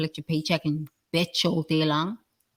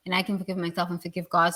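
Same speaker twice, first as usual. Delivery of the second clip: ordinary delivery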